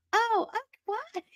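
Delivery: very high, fading confused reply; clueless mom. Retold or imitated. Mom in Just Friends answers the son, high confused. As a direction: act oblivious. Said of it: "the mom is like".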